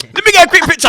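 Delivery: high, loud imitated eagerness; mock starstruck photo request; excited fan. Fan asking him for a picture, excited.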